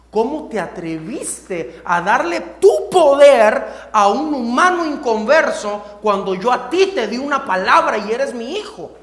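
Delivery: loud, slow stern rebuke; God's voice scolding his son, loud and stern; God rebuking. Retold or imitated. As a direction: scold like a father who was disobeyed. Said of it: "Me habló a mi espíritu el Señor y me dice".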